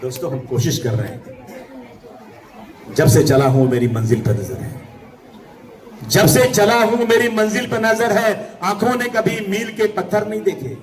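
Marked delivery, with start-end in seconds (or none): 2.9-4.8 low-pitched first reading of the verse; low verse opening. Real, not acted. First take of verse line. 6.1-10.8 declaimed couplet. Real, not acted. Verse repeated as raised declamation.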